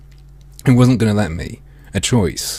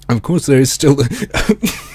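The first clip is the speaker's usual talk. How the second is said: higher, slow, laugh breaking in; cracking up while reading; laughing mid-read; 5 semitones higher, slower than usual. Real, not acted. Streamer laughing as he reads the game narration. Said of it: "I need to stop that".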